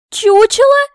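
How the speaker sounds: very high, very loud incredulous cry; piercing indignant question; outraged shriek. A narrator's reading. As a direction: explode on the repeated insult. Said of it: "Я чуть не задохнулась".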